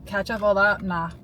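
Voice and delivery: ordinary delivery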